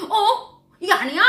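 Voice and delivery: high, loud startled question; surprised. Retold or imitated. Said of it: "그래서 내가".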